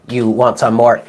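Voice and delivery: low movie-robot offer, lightly voiced; movie bar-scene quote. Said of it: "The scene that comes to mind is the bar scene".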